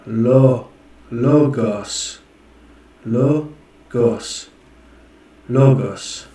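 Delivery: LOGOS said slowly with a drawn O; sounding out the O. Shown as a demonstration. A learner practising Greek aloud, sounding out the word. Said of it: "'cause it's the O".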